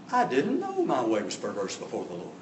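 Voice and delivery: low, soft, mock-contrite whine; whiny, wheedling. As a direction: wheedle quietly like a guilty man. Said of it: "with a whiny, wheedly voice".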